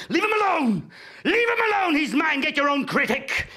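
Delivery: high, loud possessive yell; guard your critic, bellow it; furious shout. Acted. Actor fights over the critic.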